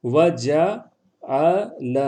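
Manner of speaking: وجعل very slowly as wa, ja, 'a, la; syllable by syllable. Shown as a demonstration. Teacher spelling out "He made", وجعل syllable by syllable. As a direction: break وجعل into drawn-out syllables with pauses. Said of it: "تو کیا کہیں گے؟".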